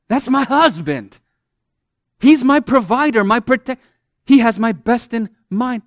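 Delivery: a wife's proud praise growing stronger; proud wife, rising. Retold or imitated. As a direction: voice Sarah bragging about her man. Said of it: "if I can translate for you".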